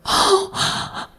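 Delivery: sharp breathy gasp of shock; mock gasp. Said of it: "all the women were like".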